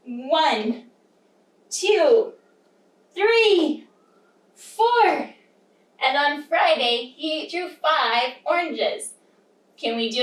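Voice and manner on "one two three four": emphatic bite counting. Pretend. Teacher counting caterpillar bites with children, playful and building. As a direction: count each big chomp with rising gusto.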